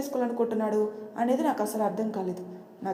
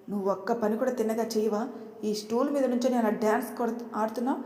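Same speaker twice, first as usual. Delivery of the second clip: plain reading voice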